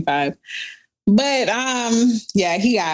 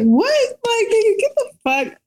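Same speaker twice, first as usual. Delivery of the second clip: mock offended outburst; 10 semitones higher than usual. Answering the boyfriend who questions her snooping, high indignant outburst. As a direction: fire it back, outraged and disbelieving. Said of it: "I'm literally offended at this point".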